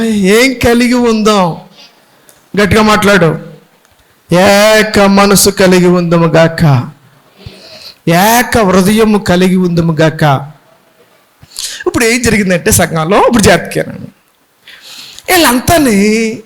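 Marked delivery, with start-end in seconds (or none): 4.3-10.5 low, slow solemn blessing. Acted. Pastor blessing the congregation, low and slow. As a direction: pronounce it slowly and low like a benediction.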